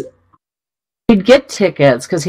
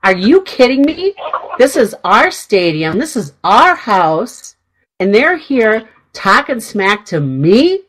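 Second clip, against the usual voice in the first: retold indignation, pitched higher; indignant outburst; 5 semitones higher, slower than usual. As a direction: act out being outraged, leaning on key words. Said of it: "was so angry".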